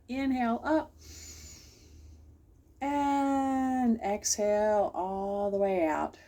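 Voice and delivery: slow, calm breathing cue; guided breathing. Shown as a demonstration. Host leads children through a closing breath, slow and calm. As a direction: stretch the exhale out slowly. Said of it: "one more deep breath".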